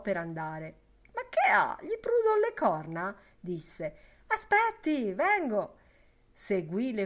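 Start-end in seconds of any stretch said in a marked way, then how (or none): 1.1-3.2 loud, very high-pitched questioning; indignant protest. A narrator's reading. Lenehan protests to departing Boylan. 4.3-5.8 high, loud, hurried call; calling after someone. A narrator's reading. Lenehan calls after departing Boylan.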